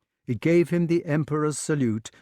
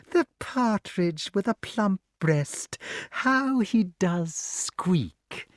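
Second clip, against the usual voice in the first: higher, slow cooing hag; gloating witch; 8 semitones higher, much slower than usual. A narrator's reading. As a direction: savour every word.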